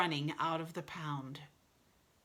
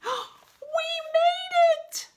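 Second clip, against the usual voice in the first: gasp, then a high drawn-out cheer; triumphant squeal; 8 dB louder, much slower than usual. A narrator's reading. They land through the window, elated squeal. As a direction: celebrate loudly, stretching each word.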